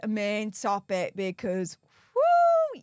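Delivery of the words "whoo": excited whoop. Real, not acted. Cheering before the main topic.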